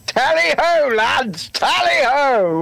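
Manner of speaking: high, drawn-out cavalry cry; rally the lads, slowly; hammed-up tally ho. Echoing mock charge.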